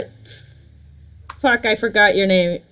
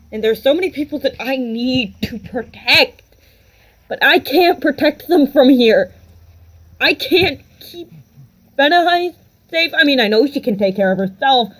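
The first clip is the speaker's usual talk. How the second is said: raised, urgent worrying in character; agitated, higher-pitched acted fretting; anguished worry; 4 semitones higher than usual. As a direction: spill out fears of failing to protect them. Character frets over protecting loved ones.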